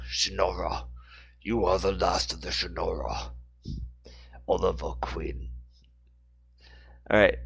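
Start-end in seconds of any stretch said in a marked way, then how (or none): 0.1-5.5 low, deliberate villain line; lightly voiced Deathstroke. Shown as a demonstration. Host demos the villain voice, a hint of menace. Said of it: "he says things like".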